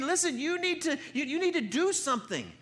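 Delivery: high-pitched goading; Elijah poking at them. Acted. Elijah goading Baal's prophets to act, high taunt. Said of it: "he starts poking at him".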